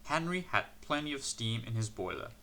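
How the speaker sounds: ordinary delivery, nothing marked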